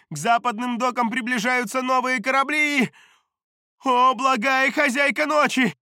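high, loud frantic report; panicked shouting. A narrator's reading. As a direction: cry the news in panic. Panicked messenger reports the attack.

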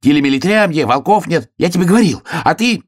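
high, climbing reproach; upset hare. A narrator's reading. Hare reproaches, rising in distress. (возмущённо, всё выше, по-заячьи)

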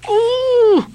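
high squeal of protest; frightened cry. Acted. Doughboy denying blame to Stubb, frightened high cry. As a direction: yelp out your innocence. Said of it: "cried Doughboy".

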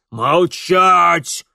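loud, high furious bark; barked command. A narrator's reading. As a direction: cut the children off with a roar. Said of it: "рявкнул Шабельский".

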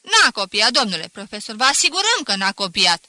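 loud, stirred-up class yelling together; whole class shouting at the teacher; riled-up shouting. Acted. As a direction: shout it out, itching for a row. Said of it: "strigă întărâtată, pusă pe scandal".

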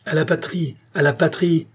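PATRIE thrown back high, said twice; indignant repetition. A narrator's reading. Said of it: "répéta la reine".

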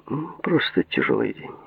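softly hesitant, opening with a small hum; hesitate, then answer quietly. A narrator's reading. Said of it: "поколебавшись, ответил он". (нерешительно, негромко, чуть помедлив)

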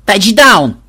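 loud, high yell of тачдаун; yelled claim. A narrator's reading. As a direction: shout Тачдаун! at the opponent. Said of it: "вопила внучка".

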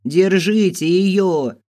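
high, loud, stretched command; shouted order. A narrator's reading. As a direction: call to the servants to seize her. Mistress shouts orders to catch runaway. (протяжный крик-приказ)

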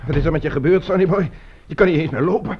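put-on loud, startled questions to the hurt boy; alarmed outcry. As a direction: call out in alarm. Shocked at injured boy. (geschrokken, luide uitroep)

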